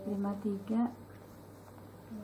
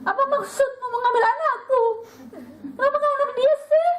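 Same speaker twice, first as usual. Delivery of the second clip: overacted soap opera grief, crying through the words; 10 semitones higher than usual. Parodying a soap mother demanding why God took her child, mock sobbing. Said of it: "kayak di sinetron".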